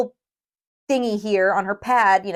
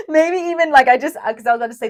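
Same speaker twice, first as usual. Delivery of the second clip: laughing; 8 semitones higher, 6 dB louder, faster than usual. Real, not acted. Laughing outburst mid-sentence.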